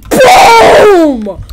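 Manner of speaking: loud, high, drawn-out boom; slam sound effect. Pretend. Player acting out a wrestling slam, booming shout. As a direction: shout it like a huge impact.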